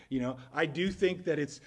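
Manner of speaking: ordinary talk, nothing marked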